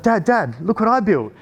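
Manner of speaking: put-on high, eager child calling his dad; a boy proudly showing off; excited kid. Son showing dad his Minecraft house, eager and high.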